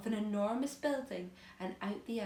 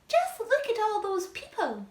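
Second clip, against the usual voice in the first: shrill aunt voice; 12 semitones higher, 8 dB louder than usual. Acted.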